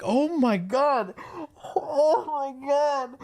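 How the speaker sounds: slow, high drawn-out disbelief; exasperated groan. Retold or imitated. Reacting to the quest dialogue, slow and exasperated. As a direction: drag it out in disbelief. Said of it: "I'm like".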